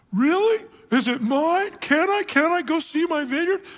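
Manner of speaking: put-on high, childlike eager questions; hammed-up delighted disbelief; giddy excitement. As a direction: act thrilled, pitch leaping way up. Delighted Ahab asks about his vineyard.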